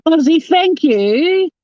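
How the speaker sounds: high, loud laughing thank you; real delighted squeal of thanks; excited thanks. Real, not acted. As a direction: gush thanks through a laugh.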